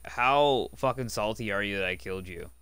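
ordinary talk, nothing marked

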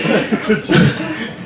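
warm chuckle at her own joke; soft laugh. Real, not acted. Laughs after her own pun.

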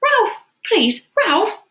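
very high, loud desperate call; high-pitched beseeching yell; pleading shout. A narrator's reading. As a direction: shout after someone, begging. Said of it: "Piggy shouted".

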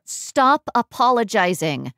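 slow, exasperated command; exasperated cut-in. Acted. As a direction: cut him off, drawing out each word. Cuts off his apology.

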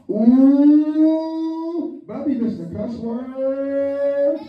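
slow teasing drawl; slowly mocking the daughter's words; drawn-out mock. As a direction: stretch it out to tease.

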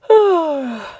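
high, loud contented sigh; sleepy sigh. Acted. Bear cub settling for winter, sighing.

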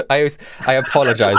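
light Canadian lumberjack apology; lightly voiced Canadian apology; Canadian accent. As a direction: say sorry like a friendly Canadian.

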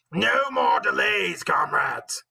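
high, slow rallying shout; crying out. A narrator's reading. Napoleon rallying the animals to work, crying out. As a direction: call the crowd to order, drawing it out. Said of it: "cried Napoleon".

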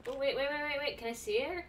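normal talking voice

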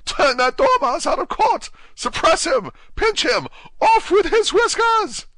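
string of piercing, high-pitched orders; loud falsetto royal tantrum; shrieking Queen. A narrator's reading. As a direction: screech each command at the top of the voice. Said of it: "the Queen shrieked out".

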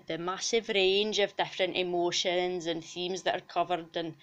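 gushing, sweary Scottish mascot character; broad Scots character voice, full of enthusiasm; enthusiastic Scottish cow. Acted. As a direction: play an excitable Scottish cow in an interview.

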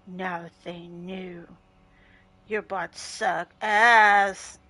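slow, sulky drawn-out grumble; mock a sulking player, dragging the words; pouting Trevor. Streamer imitating pouting teammate Trevor, slow and sulky. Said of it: "He's pouting".

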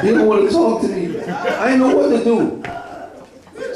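high, breaking tearful voice, slowing down; choked up, voice climbing as tears come; crying for real. Real, not acted. Telling the church about his pastor, crying for real. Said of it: "I'm crying for real".

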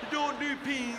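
mouthing words to a friend outside a window; talking through glass. Retold or imitated. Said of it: "You gotta talk like that through glass".

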